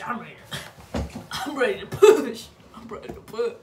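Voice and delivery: laughing through eager words. Real, not acted. Patient eager to push, laughing. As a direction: giggle while saying it.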